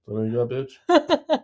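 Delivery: slow, high, hammed-up tough-guy taunt; playful mock taunt. Husband joking he'd taunt his wife mid-squeeze, hammed-up. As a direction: taunt her playfully, drawn out.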